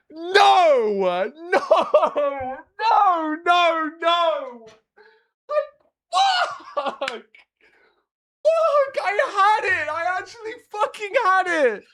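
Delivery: high-pitched wailing frustration; anguished disbelief. Real, not acted. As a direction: wail at throwing away a sure win. Agonised over losing the round.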